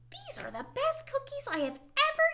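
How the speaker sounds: high, bright storybook frog; squeaky high character voice. Acted. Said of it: "said Frog".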